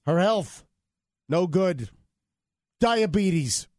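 clipped choppy Trump impression; staccato Trump voice. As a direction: chop each word short like Trump.

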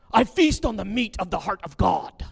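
loud, grand pompous boast; boastful mock believer. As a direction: puff up like a showy saint. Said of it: "mimicking boastful believer".

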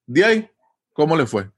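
"De ahí" jumped up high, then "¿cómo le fue?" plain; de ahí added. Shown as a demonstration. Asking a friend how it went, "De ahí" sung up high. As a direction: throw "De ahí" up high first. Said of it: "Pero yo quiero agregar".

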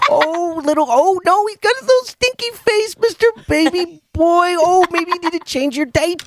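high cooing dad voice to his infant son; daddy baby talk. Shown as a demonstration. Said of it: "let's hear some daddy baby talk to him".

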